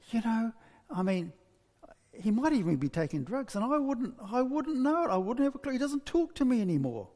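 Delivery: high-pitched, fretting mother act; acted distressed mother; worried unburdening. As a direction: let the worries spill out, pitch climbing. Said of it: "she's been dying to tell somebody".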